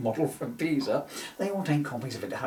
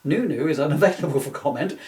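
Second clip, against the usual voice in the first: plain reading voice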